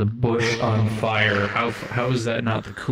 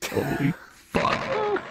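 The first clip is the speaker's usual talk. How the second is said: a much higher, slow squeal of shock; 4 dB quieter, much slower than usual. Real, not acted. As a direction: let it squeak out in disbelief. Shocked outburst after a nasty moment.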